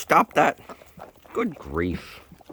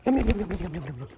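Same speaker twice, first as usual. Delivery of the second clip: high sing-song yum yum; high cooing; 9 semitones higher, faster than usual. Real, not acted. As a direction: coo at the birds as they eat. Cooing to eating chickens.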